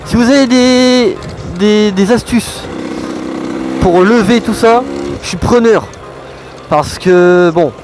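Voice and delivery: talking loud inside a helmet while riding. Real, not acted. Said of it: "on se retrouve tout de suite dans le casque".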